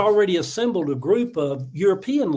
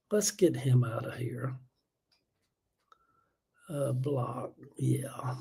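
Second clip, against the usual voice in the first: real under-breath whisper while blocking; whispered aside; 5 semitones lower, 9 dB quieter, slower than usual. Real, not acted. Streamer whispering to himself while blocking a commenter. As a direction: drop to a whisper so viewers barely hear. Quietly blocking a troll live.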